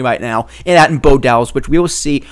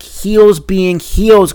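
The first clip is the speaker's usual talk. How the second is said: Heels being heels drawn out slowly and high; podcast host savouring his catchphrase; 4 semitones higher, 4 dB louder, much slower than usual. Shown as a demonstration. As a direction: stretch each word slowly, catchphrase style. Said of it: "I love saying it".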